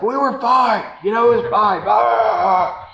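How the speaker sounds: high-pitched, exasperated mock yelling; retold ugly scream. Husband yelling at wife after slamming brakes, ugly mock scream. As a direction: imitate yourself snapping in alarm, ending in a roar. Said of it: "I scream ugly at her".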